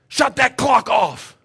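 high, loud angry yell at the clock keeper; retold yell. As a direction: bellow it across the gym. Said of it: "I yelled at the clock keeper".

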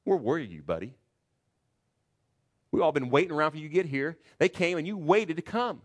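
loud, high, fast sarcastic jab; mock him for showing up late; sarcastic mockery. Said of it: "This is sarcastic".

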